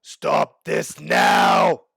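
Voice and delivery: loud, drawn-out booming command; booming shout. Acted. Armorer breaks up the fight, booming shout. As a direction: boom it out slowly, each word held. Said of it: "a booming voice cut through".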